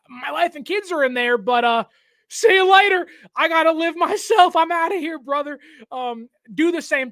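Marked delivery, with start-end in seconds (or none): 0.1-5.6 movie character fleeing. Retold or imitated. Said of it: "and the guy's like".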